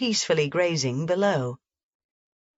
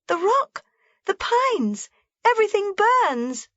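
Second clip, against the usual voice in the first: put-on much higher, thrilled girl's shout; excited shouting; 13 semitones higher than usual. A narrator's reading. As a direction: cry out at the blazing sunset.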